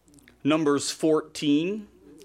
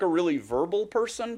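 ordinary talk, nothing marked

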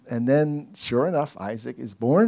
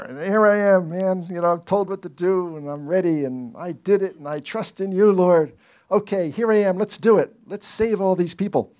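eager here I am; 7 semitones higher, 4 dB louder than usual. Acted. Preacher acts out eager servant.

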